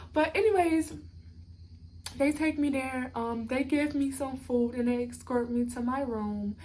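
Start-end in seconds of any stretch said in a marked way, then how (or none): none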